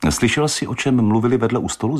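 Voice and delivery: quick, nosy asking; a light woman's voice, curious. Acted. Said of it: "zeptala se ho".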